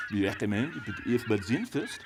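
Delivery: a French waiter's mock French accent, politely advising. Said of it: "say in his best French accent".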